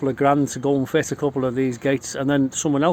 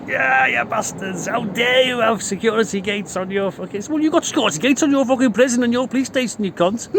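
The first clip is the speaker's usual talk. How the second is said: higher, slowed at the start, a sneering rant at the cops; mock rant; 7 semitones higher than usual. Speaker mocking police over their security gates, sneering rant.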